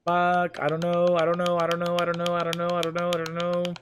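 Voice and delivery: panic, can't choose; flustered panic. Real, not acted. Panic at the choice.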